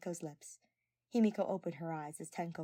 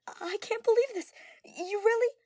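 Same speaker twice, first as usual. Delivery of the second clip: much higher, loud delighted disbelief; overjoyed disbelief; 11 dB louder than usual. Acted. Girl learning her crush likes her back, loud and high. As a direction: burst out in happy disbelief.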